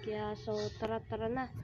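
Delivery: hushed, soft sneaking voice; whispering while hiding. Pretend. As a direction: keep it down so Granny won't hear. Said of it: "Dahan-dahan lang po, guys. Baka marinig tayo".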